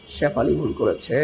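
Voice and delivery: drawn-out teasing. Pretend. Teacher answering who made the mistake, drawn-out teasing. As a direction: stretch Shefali and করেছে out long and low. Mock verdict after "কে করেছে?.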